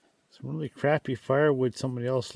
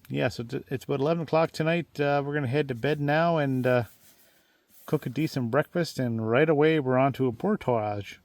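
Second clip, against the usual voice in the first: normal talking voice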